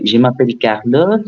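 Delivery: ordinary talk, nothing marked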